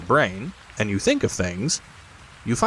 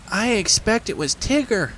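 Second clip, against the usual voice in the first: nervous Piglet; 13 semitones higher than usual. Acted. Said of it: "said Piglet nervously".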